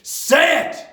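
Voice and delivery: very loud, high roar; bellowing. A narrator's reading. Headmistress forcing the boy to answer, bellowing. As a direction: bellow the order. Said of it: "bellowed the Trunchbull".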